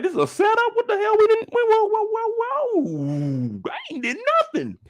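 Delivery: very high, scared squeaky voice; terrified falsetto pleading with the dad; voice cracking high. Retold or imitated. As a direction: lose all the bass in your voice. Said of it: "The voice changes. I ain't got no bass in my voice".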